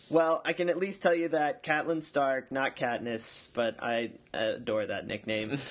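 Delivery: usual speaking voice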